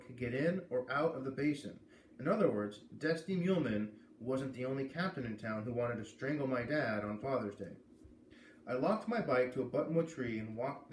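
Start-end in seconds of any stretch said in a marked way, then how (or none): none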